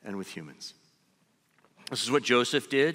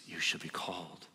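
softer, lower, almost to himself; hushed echo; 6 semitones lower, 9 dB quieter than usual. Acted. Preacher softly repeating God's call to the listener, hushed. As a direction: let the calling sink in quietly.

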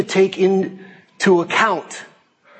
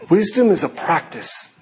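ordinary talk

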